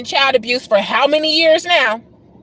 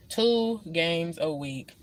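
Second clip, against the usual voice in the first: Two games a week said much softer and slower; weary quiet repeat; 7 semitones lower, 11 dB quieter, slower than usual. Real, not acted. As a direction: let Two games a week sink into a tired murmur. Repeats complaint, then fades.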